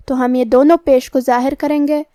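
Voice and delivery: ordinary talk, nothing marked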